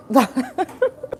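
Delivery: real high-pitched laugh. Real, not acted. Laughing at her own "Grand Rising" mockery, high laugh.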